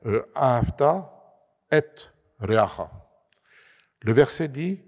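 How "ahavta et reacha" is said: ahavta et reacha said in Hebrew, set apart from the French; Hebrew phrase. Shown as a demonstration. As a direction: read the Hebrew words ahavta, et, reacha carefully. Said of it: "On dirait".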